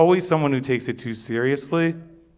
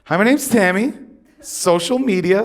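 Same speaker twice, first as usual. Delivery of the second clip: chirpy Tammy; 7 semitones higher, 3 dB louder than usual. Retold or imitated. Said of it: "Tammy will say something like".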